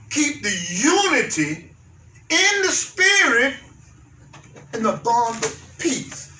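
hushed, slow verse; slow scripture reading. Shown as a demonstration. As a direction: let each word hang.

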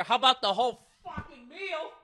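high, rising outburst; mock indignation. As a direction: boast indignantly, voice climbing high. Mock-indignant answer to listener's question.

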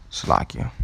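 unvoiced whisper off mic; whispered aside. Real, not acted. To Salakia, a whispered aside. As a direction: hush it to the person nearby.

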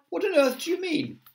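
very high, incredulous question; astonished cry. A narrator's reading. Crowd questioning the ladybird, astonished cry. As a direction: demand it in high-pitched disbelief. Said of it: "they cried".

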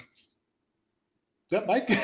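slow, drawn-out stunned question; a dazed half-whisper of disbelief. Retold or imitated. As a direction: let it hang in disbelief. Stunned Mike walked by.

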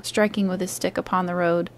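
plain reading voice

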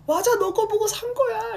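very high, loud squealed reply; high gushing fan voice; excited fan. Retold or imitated. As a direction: squeal back like a thrilled fan. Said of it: "이러면서".

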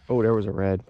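ordinary delivery

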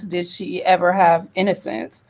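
ordinary talk, nothing marked

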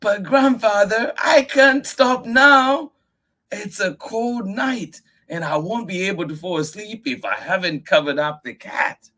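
put-on very high, loud child outcry; crying out. Miyuki crying out to grandfather. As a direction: protest loudly.

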